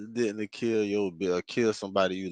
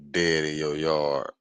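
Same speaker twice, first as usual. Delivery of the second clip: ordinary delivery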